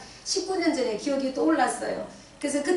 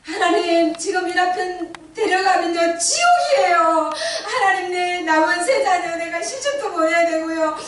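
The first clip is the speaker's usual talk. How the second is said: high and loud, breaking into sobs, ending in a shout; weeping wife pleading that she can't raise three sons alone; crying desperate plea; 8 semitones higher, 8 dB louder, slower than usual. Retold or imitated. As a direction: beg God through tears, building to a scream. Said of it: "절박하게 정말로 간절한 심정으로".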